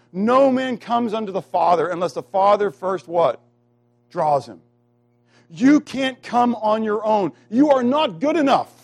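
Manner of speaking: loud, high-pitched preaching with drawn-out stresses; emphatic preaching. Real, not acted. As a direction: drive each phrase home from the pulpit.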